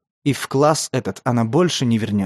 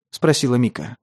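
ordinary delivery, nothing marked

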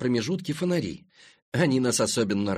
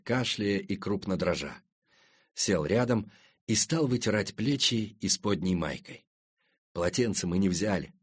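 ordinary delivery, nothing marked

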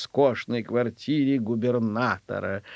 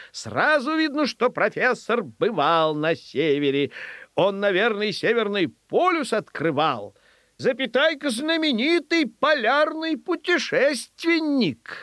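high, marvelling exclamations; admiring crowd; 8 semitones higher than usual. Acted. Audience marvels at the learned dog, admiring crowd. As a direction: build to a high, grand proclamation.

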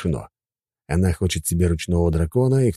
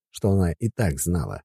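ordinary delivery

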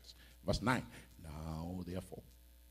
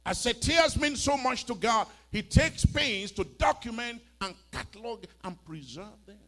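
loud, high, slow drawn-out preaching; a loud, slow proclamation; emphatic preaching; 10 semitones higher, 12 dB louder than usual. Real, not acted. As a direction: stretch each word out to drive it in. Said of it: "You didn't hear what I said".

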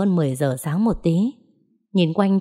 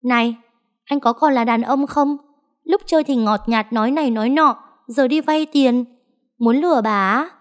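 angry scolding; 7 semitones higher than usual. A narrator's reading. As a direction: tear into him with scorn. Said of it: "quắc mắt chửi". (cô bồ quắc mắt chửi bạn trai, giọng cao khinh miệt)

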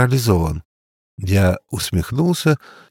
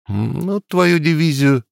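grumbling; 6 semitones higher than usual. A narrator's reading. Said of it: "буркнул мой друг".

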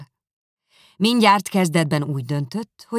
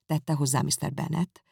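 usual speaking voice

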